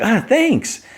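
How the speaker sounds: very high, acted gushing thanks; mock delight. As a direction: play someone thrilled with a gift. Delighted acceptance of offered award.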